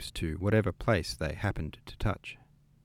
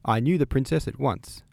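eager; 5 semitones higher, 5 dB louder than usual. A narrator's reading. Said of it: "put in Mademoiselle Bourienne".

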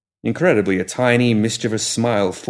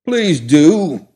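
put-on higher, louder prim old gentleman; grumpy old gentleman; 7 semitones higher, 6 dB louder than usual. A narrator's reading. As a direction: voice him as a fussy old man. Muffled voice from the pram.

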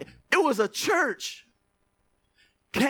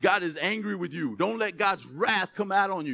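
ordinary delivery

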